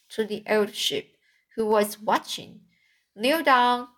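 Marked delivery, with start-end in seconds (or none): none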